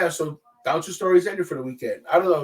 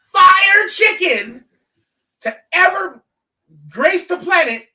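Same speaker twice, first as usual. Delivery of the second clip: loud, high, drawn-out proclamation; big high-pitched hype, word by word; emphatic hype; 12 semitones higher, 8 dB louder, slower than usual. Real, not acted. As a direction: shout it out as grand praise. Hyping up the red tent chicken.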